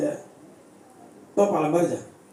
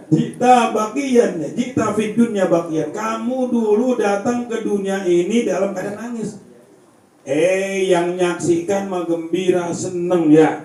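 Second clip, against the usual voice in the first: fiery preaching; 9 semitones higher, 10 dB louder than usual. Acted. Preacher to the village congregation, loud and high. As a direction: preach it to the congregation with full force. Preacher repeats verse, louder.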